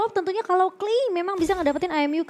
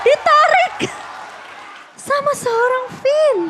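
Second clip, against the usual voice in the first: much higher and louder, then slow; excited shout; 10 semitones higher, 6 dB louder, slower than usual. Real, not acted. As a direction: call the hook in disbelief. Co-caster reacts to play.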